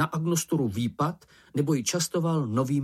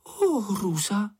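high, drawn-out wail; horrified cry; 8 semitones higher, slower than usual. A narrator's reading. Slečna Ferka after hitting Mumínek by mistake, horrified cry. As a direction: cry out slowly in high dismay. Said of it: "vykřikla".

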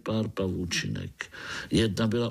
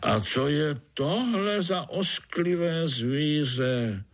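higher, slow, drawn-out question; disgusted old spider; 7 semitones higher, slower than usual. A narrator's reading. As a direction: stretch the question out in distaste. (znechuceně a táhle)

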